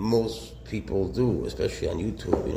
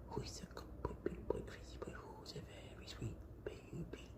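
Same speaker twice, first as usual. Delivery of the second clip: put-on much softer, whispered baby talk; cooing whisper; 11 semitones higher, 18 dB quieter than usual. Shown as a demonstration. Owner demonstrates whispering baby talk to her cobra. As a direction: whisper coochie-coo to the baby. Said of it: "I'll show you what".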